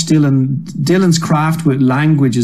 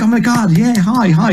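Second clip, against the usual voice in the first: mock eager Canadians; 7 semitones higher than usual. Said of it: "all the Canadians like".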